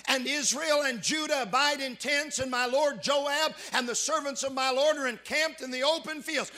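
loud, high preaching cry; fiery pulpit delivery; full-force preaching. Acted. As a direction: preach it out to the back pews.

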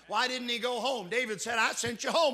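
loud, high preaching cry; fiery pulpit delivery; full-force preaching. Acted. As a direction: preach it out to the back pews.

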